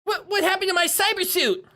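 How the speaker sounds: put-on petulant child villain complaint; whiny kid villain. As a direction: whine like a spoiled kid who lost. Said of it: "I want him to have a really whiny voice".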